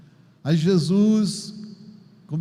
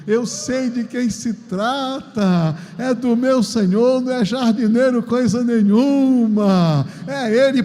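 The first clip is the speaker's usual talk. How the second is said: building from slow to higher and louder recognition; Mary's joyful recognition; 4 semitones higher, 6 dB louder, slower than usual. Acted. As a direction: let the joy rise until it bursts. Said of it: "Ela percebeu".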